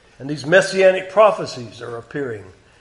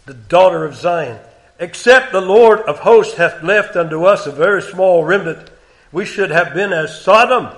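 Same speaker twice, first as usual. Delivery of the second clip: raised, deliberate King James reading; solemn scripture reading; 5 dB louder than usual. Acted. Preacher reading God's words to congregation, raised. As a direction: read it as God's complaint against Israel.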